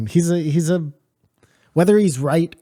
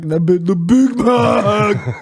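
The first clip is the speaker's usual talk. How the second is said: high, loud, drawn-out comic impression; Eddie Murphy; 9 semitones higher, 5 dB louder, much slower than usual. Co-host finishing the Delirious joke, mock stand-up impression. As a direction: stretch it out high like a stand-up punchline. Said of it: "because that's Eddie Murphy".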